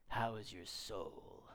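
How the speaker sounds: slow, soft, ominous question; scary tone. Acted. Churchgoer cornering someone in the lobby, ominously solemn. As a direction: stretch it out low and creepy. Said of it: "like that in that tone of voice".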